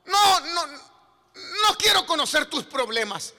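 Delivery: shouted, high-pitched sermon climax; full-force preaching. Real, not acted. Preacher proclaiming Peter's miracle to the congregation, shouted fervent preaching. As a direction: thunder out the power of God.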